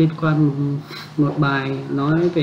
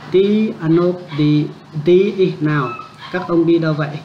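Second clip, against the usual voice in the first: slow model sentence. Shown as a demonstration. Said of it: "à, đó".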